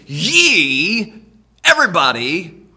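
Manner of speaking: "ye" shouted to the whole crowd; stress on ye. Shown as a demonstration. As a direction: hit "ye" hard and wide. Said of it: "Nicodemus, I'm talking to you".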